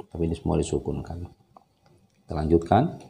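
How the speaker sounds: normal talking voice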